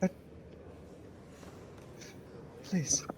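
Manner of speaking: high, soft whimper; frightened prisoner pleading weakly; scared pleading. Acted. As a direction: beg in a near whisper, faltering. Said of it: "He's too scared".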